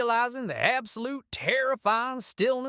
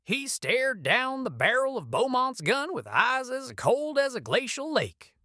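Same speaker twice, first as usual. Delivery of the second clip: ordinary delivery, nothing marked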